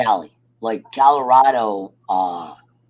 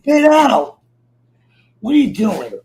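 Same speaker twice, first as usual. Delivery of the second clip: loud, higher calls at the dog; scolding the dog; 5 semitones higher, 6 dB louder than usual. Real, not acted. To his restless dog, loud scolding calls. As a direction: bark it at the misbehaving dog.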